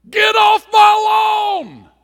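put-on cranky neighbor screaming at kids to get off his lawn; angry scream. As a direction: yell like a furious old neighbor. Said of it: "Do you see the difference?".

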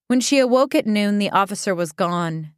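ordinary delivery, nothing marked

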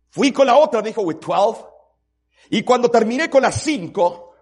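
loud, high sermon cry; fiery sermon tally; preaching at full force. Acted. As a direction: build the tally from the pulpit. Preacher counting the victims.

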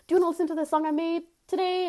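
hyped-up offer; eager pitch. Retold or imitated. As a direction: rush it like an excited guy. Imitating eager manic guy.

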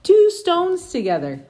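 Two sung out high, loud and slow; stress on two. Shown as a demonstration. Parent stressing Two for toddler Flora, high and slow. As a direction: lean on Two for the baby. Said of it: "Flora's learning the number two".

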